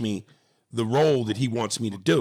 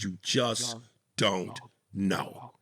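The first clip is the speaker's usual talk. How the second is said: slow, low, soft words; measured caution; 3 semitones lower, 6 dB quieter, much slower than usual. Real, not acted. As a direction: let DON'T KNOW sink, spaced and soft. Cautions himself, then repeats it.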